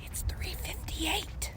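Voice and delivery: hushed whisper, slow; breathy secret whisper, slow. Real, not acted. Late-night whispered confession.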